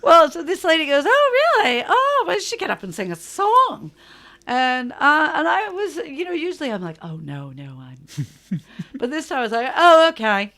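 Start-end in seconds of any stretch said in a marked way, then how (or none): 1.1-3.9 high, loud, excited mimicry; delighted proprietor. American proprietor asking the singer up on stage, excited mock squeal. Proprietor urging her to sing, acted. 7.0-8.6 lower, softer mock demurral; reluctant refusal. Singer usually declining to perform, low reluctant murmur. 9.8-10.5 high, loud mock agreement; eager acceptance. Singer this time agreeing to perform, high eager yes.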